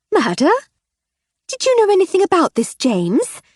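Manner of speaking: rounding on her husband, sharp and high; wife turns sharply on husband; sharp demand. Acted. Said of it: "she asked her husband sharply".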